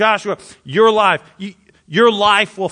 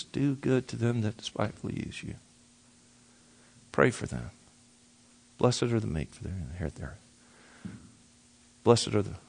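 low, soft muttering of the verses, almost under the breath; muttered scripture; 9 semitones lower, 14 dB quieter than usual. Shown as a demonstration. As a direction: murmur the Beatitudes to yourself, low and hushed. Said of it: "Haga, mutter it".

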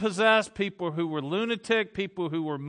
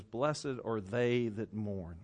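very slow, much softer and lower; tender blessing; 8 semitones lower, 7 dB quieter, much slower than usual. Retold or imitated. Jesus comforting the widow with an arm around her, slow and soft. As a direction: draw each word out gently. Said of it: "and he says".

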